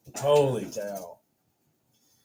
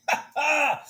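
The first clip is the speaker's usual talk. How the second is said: high laugh of relief. Real, not acted. After the win.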